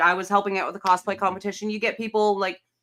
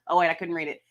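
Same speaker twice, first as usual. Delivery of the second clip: ordinary talk, nothing marked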